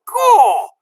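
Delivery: high, loud Gaw; amazed exclamation. A narrator's reading. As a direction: blurt out delighted. Mig exclaims.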